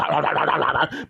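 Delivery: rapid-fire barking noise; imitated dog barking. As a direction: bark in a quick repeated burst. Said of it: "making that sound".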